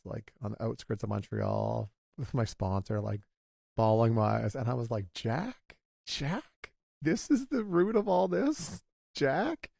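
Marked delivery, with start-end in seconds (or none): 5.1-9.7 high, pleading cry of disbelief, calling out the name; calling a grandfather's name in shock, retold to a friend. Speaker relives his breakdown with his sponsor, high and pleading.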